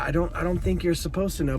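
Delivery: usual speaking voice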